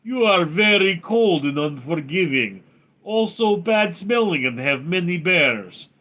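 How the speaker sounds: hammed-up mock-Russian voice; Soviet Russian accent. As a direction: declare it in a thick comic Russian accent. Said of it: "Soviet Union accent made".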